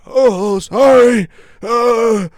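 put-on high, loud old-man apology; startled old man. Old man apologising to officer, high startled voice. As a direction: cry out a flustered apology. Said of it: "And the old man's like".

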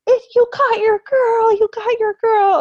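high, loud, tearful cry of joy; overjoyed mom. Retold or imitated. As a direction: shout it like a thrilled grandmother. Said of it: "my mom just was like".

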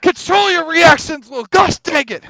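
high, loud, mock stern telling-off; mock scolding. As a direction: scold him like a parent.

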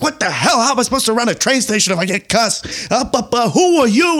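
loud, high-pitched exasperated rant; irate bitching. Retold or imitated. Film fan imitates a train boss spinning in circles, irate bitching. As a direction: rant upward to an outraged peak on the last question. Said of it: "starts bitching and spinning in circles".

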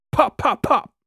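high, loud percussive pops; acted test-fire bursts; imitated engine pops. A narrator's reading. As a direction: fire off three sharp pops.

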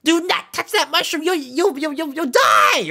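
panicked little Toad shouting a warning. Retold or imitated. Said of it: "and then Toad was like".

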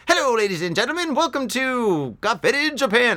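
badly faked transatlantic show-host accent; James Cagney accent. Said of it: "I can't do a James Cagney accent".

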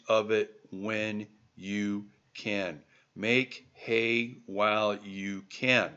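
slow, word-by-word advice; emphatic slow delivery. Real, not acted. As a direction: spell out the lesson with weight on each word. Saying driven home word by word.

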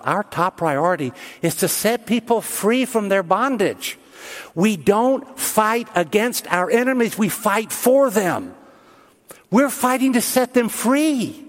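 high, slow, stretched-out proclamation; emphatic preaching. Real, not acted. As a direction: drive each phrase home slowly to the congregation. Preaches the point at high pitch.

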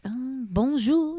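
slow French bonjour; French waiter. Retold or imitated. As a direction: greet like a Paris waiter. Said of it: "The waiter was like".

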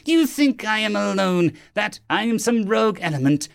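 high-pitched, impassioned villain rant; aggrieved alien villain; furious alien captain. Acted. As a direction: rail against the council's injustice.

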